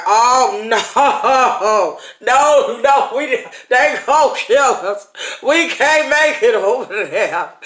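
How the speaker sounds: loud, laughing mock panic at the giants. Preacher voicing the terrified spies, hammed-up panic. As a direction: act out the scared spies, half laughing. Fearful spies' report acted out.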